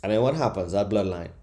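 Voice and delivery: ordinary talk, nothing marked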